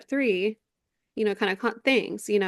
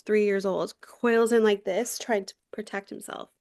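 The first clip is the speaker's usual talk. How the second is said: ordinary delivery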